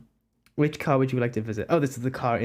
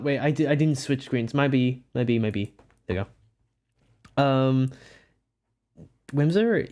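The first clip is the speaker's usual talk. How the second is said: ordinary delivery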